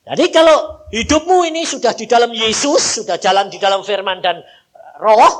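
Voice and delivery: preaching at full force. Acted. Preacher urging the church, loud. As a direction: proclaim it to the congregation.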